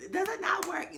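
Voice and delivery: high, put-on puzzled shopper; mock clueless customer. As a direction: play the customer acting surprised. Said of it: "People always be".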